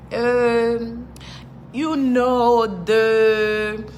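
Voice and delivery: stretched, hesitant fumbling for a word; groping for words. Retold or imitated. Newcomer in a store hunting for English words, groping. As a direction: drag out "the" very slowly while searching. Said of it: "Trying to come out".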